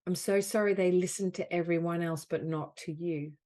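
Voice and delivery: projected, emphatic apology phrases with long pauses; guided healing affirmation. Acted. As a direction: offer each phrase firmly to be felt.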